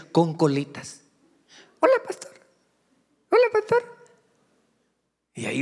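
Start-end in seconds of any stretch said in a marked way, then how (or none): 1.8-3.8 put-on very high childish squeak; high-pitched mock little girl. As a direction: imitate a sweet little girl greeting the pastor. Pastor imitates little girls greeting.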